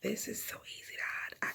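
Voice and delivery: whispering. Real, not acted. Hiding the prank item, whispering.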